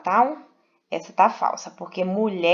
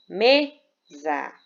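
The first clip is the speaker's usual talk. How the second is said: mesa split as ME-sa, strong ME; stress on penultimate syllable; 2 semitones lower, 3 dB louder than usual. Shown as a demonstration. Teacher giving a paroxytone example, mesa with stressed first syllable. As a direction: lean on ME in mesa, pause, then drop to sa. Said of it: "sílaba é a sílaba mais forte".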